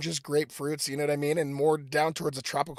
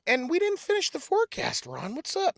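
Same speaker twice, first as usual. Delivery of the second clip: ordinary talk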